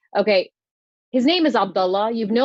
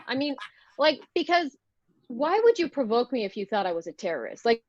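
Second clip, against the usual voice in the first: ordinary talk, nothing marked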